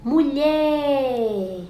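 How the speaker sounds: "Mulher" called high with the last syllable drawn out; "Mulher" with a lingering stressed ending; calling out the word. Shown as a demonstration. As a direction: hold the LHER of "Mulher" long, like a call. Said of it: "a sílaba que eu mais demorei".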